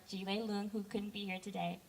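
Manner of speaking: normal talking voice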